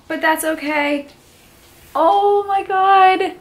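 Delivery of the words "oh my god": emotional awe. Real, not acted. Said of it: "I'm gonna start crying".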